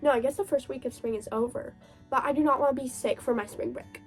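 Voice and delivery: ordinary talk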